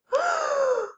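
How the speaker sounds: high sharp gasp of surprise; big mock gasp. Storytime teacher gasping in pretend shock for the kids.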